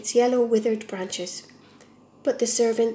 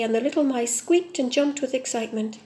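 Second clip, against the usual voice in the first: ordinary delivery, nothing marked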